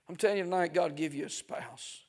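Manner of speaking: ordinary talk, nothing marked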